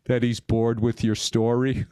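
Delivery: low, slow deadpan punchline. Retold or imitated. Kenny reads Todd's body language for his friend, deadpan. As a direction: imitate a dry, unimpressed reader, dropped low.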